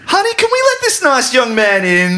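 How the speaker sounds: high, sweet put-on voice of a trusting homeowner; mock innocent homeowner inviting the vampire in; naive homeowner voice. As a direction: play the gullible host, way up high.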